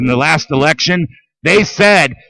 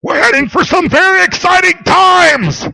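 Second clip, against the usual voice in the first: pitched high, half-shouted warning; a mock-cheerful warning shout; 11 semitones higher than usual. Real, not acted. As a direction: yell it like a prophecy. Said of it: "ominous rant climax".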